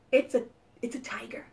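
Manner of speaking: quick excited whisper; hushed, fast reveal. Acted. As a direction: whisper it like a secret.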